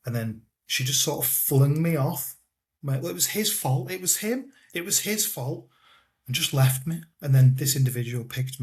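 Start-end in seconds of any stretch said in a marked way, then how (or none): 2.8-5.7 higher-pitched imitation of blaming someone else; lightly acted, high-voiced self-excusing; retold blame-shifting. Retold woman shifting blame after beating.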